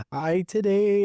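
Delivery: high, halting broken English; imitating a Malaysian lady's broken English; mock broken-English quote. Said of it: "broken English".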